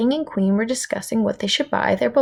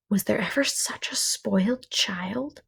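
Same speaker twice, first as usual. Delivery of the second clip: whispered thought; 4 dB quieter than usual. Acted.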